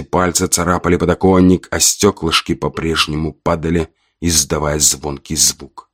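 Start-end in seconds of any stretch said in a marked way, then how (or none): none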